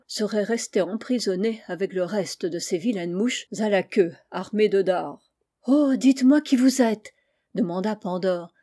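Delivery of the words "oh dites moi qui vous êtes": higher, louder admiring question; eager wonder. A narrator's reading. Pandore questioning the winged visitor, eager wonder. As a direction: ask with wide-open admiration, voice lifted.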